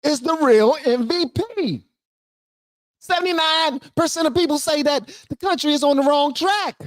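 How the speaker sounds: high-pitched triumphant proclamation; hyped-up crowing. Real, not acted. Crowing over the interviewer's point.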